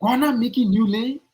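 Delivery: echoing the quote as if it makes no sense; incredulous echo. Retold or imitated. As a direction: throw the words back in disbelief. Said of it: "What is the sense in that?".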